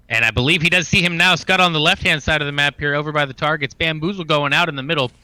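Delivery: upbeat play-by-play commentary; esports caster. Acted. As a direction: call the action like a caster. Casts the game play-by-play.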